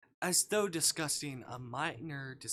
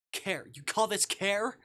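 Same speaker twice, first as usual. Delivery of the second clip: much higher, louder indignant question; outraged disbelief; 8 semitones higher, 8 dB louder, slower than usual. Acted. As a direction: spit the word care back at him. Protagonist outraged at captor.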